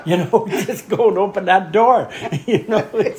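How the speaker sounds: high, loud laughing plea; pleading with Rod through laughter. Retold or imitated. Laughing while retelling the plea.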